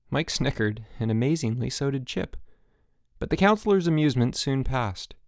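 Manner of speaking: ordinary delivery, nothing marked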